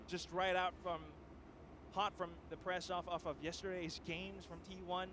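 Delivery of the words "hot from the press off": ordinary talk, nothing marked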